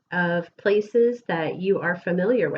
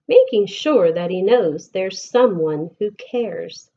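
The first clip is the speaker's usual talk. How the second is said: lilting, sing-song picture book reading; gentle storytime voice with bouncing rhythm; reading to children; 4 semitones higher, 5 dB louder than usual. Acted. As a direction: read the rhymes aloud to a class of kids. Reads picture book to class.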